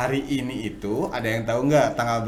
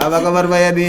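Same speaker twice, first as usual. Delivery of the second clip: louder, higher excited hello; loud cheerful greeting; 6 semitones higher, 11 dB louder, faster than usual. Real, not acted. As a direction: call out the greeting with gusto. Host greets guest loudly. (sapaan riang yang keras)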